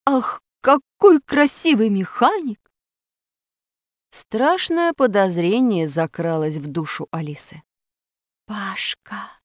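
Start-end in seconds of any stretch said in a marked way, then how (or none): 0.1-2.6 slow, high, swooning admiration; strange admiring voice. A narrator's reading. Pashka stares at the mechanic before takeoff, strange admiring voice. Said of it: "добавил странным голосом". 8.5-9.4 hushed, suspicious whisper. A narrator's reading. Alisa suspects Pashka is someone else, whispering. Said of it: "прошептала она".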